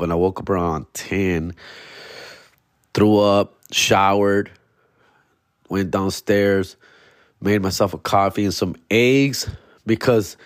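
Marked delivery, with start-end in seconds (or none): none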